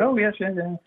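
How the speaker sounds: high-pitched imitation of happy remembering; delighted recognition. Racer reminded of his autograph, retold with delight. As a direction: play someone fondly pleased by a memory. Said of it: "he was really nice".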